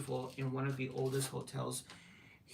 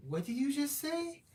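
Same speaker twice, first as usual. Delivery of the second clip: much higher incredulous mock question; retold disbelief; 13 semitones higher, 3 dB louder than usual. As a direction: act stunned at the son's British accent. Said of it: "I'm like".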